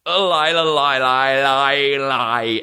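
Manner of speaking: high mock stutter, sinking gradually; stuttering king. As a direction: labour painfully over the same syllable. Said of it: "him fucking trying to speak".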